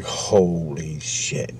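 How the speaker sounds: very slow, low and soft; quiet, slow amazement; awed disbelief. Real, not acted. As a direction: let it sink in, drawn out. Awed at the UFO account.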